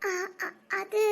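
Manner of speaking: tearful stammer to her brother; slow tearful stammer. Acted. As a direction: stumble through a sob. Sister crying, stammering.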